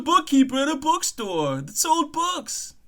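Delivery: high-pitched, protesting comic voice; indignant accused bookkeeper. Acted. Murder suspect insisting on an innocent job, high indignant protest.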